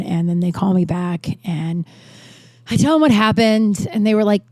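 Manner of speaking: ordinary talk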